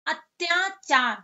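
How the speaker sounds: said slowly and carefully; careful, slow pronunciation; slow demo word. Shown as a demonstration. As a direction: draw the word out for pupils. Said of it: "इसका उच्चारण किया अत्याचार".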